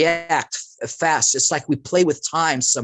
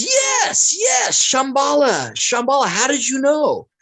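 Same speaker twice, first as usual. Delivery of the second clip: much higher, slow, foreign-sounding voice for Yes and Shambhala; a delighted holy man who can't believe you know; Dalai Lama impression; 11 semitones higher, slower than usual. Dalai Lama answering the guest's hollow earth question in a high excited voice. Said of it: "And he goes".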